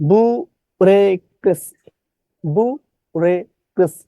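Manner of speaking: "বুরেক্রেসি" slowly, in separated syllables; distorted spelling pronunciation. Shown as a demonstration. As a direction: pause between the syllables of "বুরেক্রেসি". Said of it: "বিকৃত উচ্চারণ করবেন". ("বুরেক্রেসি" ধীরে, ভেঙে ভেঙে বিকৃত উচ্চারণ)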